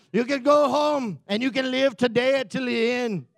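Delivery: put-on loud warrior speech in wandering accent; William Wallace. Joshua rallying his army, mock William Wallace battle speech. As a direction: proclaim it to troops, accent badly faked. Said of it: "Might be a little Russian in there".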